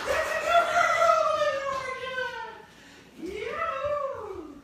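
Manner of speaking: very high, rapid-fire squealed urging; excited puppy calling. Real, not acted. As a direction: cheer the puppy on in an excited falsetto.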